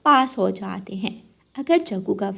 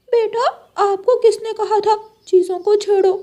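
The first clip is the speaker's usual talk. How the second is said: much higher, louder rebuke; scolding parrot; 11 semitones higher, 7 dB louder than usual. Acted. Parrot uncle scolds Jaggu for meddling, high and loud. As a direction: scold the naughty child sharply.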